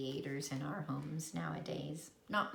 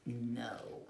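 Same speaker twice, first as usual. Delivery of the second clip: whispered no; 6 semitones lower, 5 dB quieter than usual. A narrator's reading. Narrator whispers the answer to children. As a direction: breathe a tiny no.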